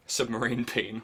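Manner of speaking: usual speaking voice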